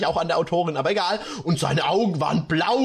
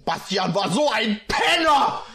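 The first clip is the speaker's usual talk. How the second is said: half-sung angry outburst; sing-song angry rant; fuming; 3 semitones higher than usual. Acted. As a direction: spit it out, furious at him. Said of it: "war stinkesauer".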